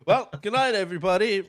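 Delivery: high, loud mock farewell; mock show sign-off. Joking the movie ends there, mock cheery sign-off. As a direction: sign off brightly as if the show is over.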